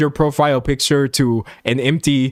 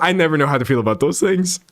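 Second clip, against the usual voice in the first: ordinary talk, nothing marked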